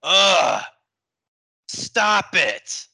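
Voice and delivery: disgusted groan, then a snapped stop it; annoyed ugh. Retold or imitated. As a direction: sound fed up and repulsed. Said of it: "there's this sense of disgust".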